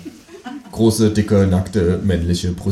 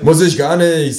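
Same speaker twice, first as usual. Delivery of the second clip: defiant retort; 8 semitones higher, 5 dB louder than usual. Acted. Fight in the dark.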